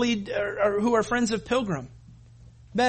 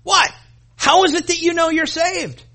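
much higher, louder, needling questions; mocking interrogator; 5 semitones higher, 4 dB louder than usual. Preacher mocking hyper-Calvinists grilling a believer, high and needling. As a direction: taunt them with doubts. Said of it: "they say".

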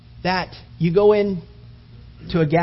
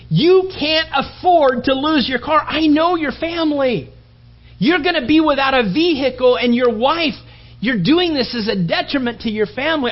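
high, loud, urgent begging; heated pleading; 8 semitones higher, 5 dB louder than usual. Acted. Friend pleads with a bettor not to lose his car, loud and urgent. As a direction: beg him not to bet his car.

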